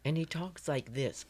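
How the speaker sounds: slow, soft upper-class drawl; high class accent. Retold or imitated. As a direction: talk like old-money high society. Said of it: "this really high class accent".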